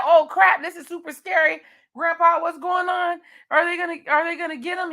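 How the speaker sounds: a hammed-up scared child asking grandpa; scared kid. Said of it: "Josh was like".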